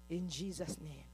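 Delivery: ordinary talk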